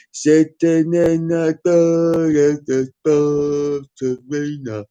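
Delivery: slow, dopey mock delivery. Ridiculing [name]'s spoken lyric, very slow dopey voice. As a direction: drag the words out like a dimwit.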